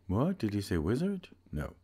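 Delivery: ordinary delivery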